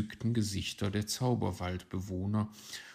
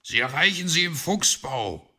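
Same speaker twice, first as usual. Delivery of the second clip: raised, loud fox voice; calling after her; 8 semitones higher, 8 dB louder than usual. Acted. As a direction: call it after someone leaving. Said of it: "rief Herr Fuchs ihr nach".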